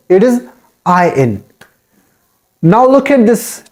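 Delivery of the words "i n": "I N" as one word, iron with silent r; "I N" gliding from eye into in, no r; corrected iron. Shown as a demonstration. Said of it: "R is totally silent here".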